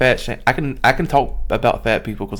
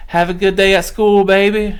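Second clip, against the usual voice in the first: put-on high, louder porch call to a child; kindly old neighbor lady; 10 semitones higher, 6 dB louder than usual. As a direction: voice an old lady sending a kid off. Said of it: "she'd be like".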